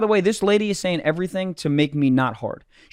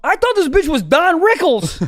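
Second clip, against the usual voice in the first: high, raised-voice mock complaint; exasperated, pitched-up rant; comic indignation; 9 semitones higher, 4 dB louder than usual. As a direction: protest it half laughing. Comic indignation at her insults.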